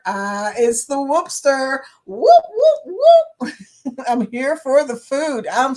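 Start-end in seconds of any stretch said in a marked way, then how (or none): 2.1-3.5 high, loud whooping hype call. Real, not acted. Host greeting the Whoopster with an excited whoop call. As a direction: whoop it up like a cheering crowd. Hyping a guest's arrival.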